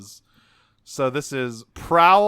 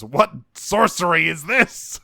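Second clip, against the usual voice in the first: hammy pirate, louder; 4 semitones higher, 5 dB louder than usual. Retold or imitated. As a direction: ham up the pirate's alarm. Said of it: "the pirate goes".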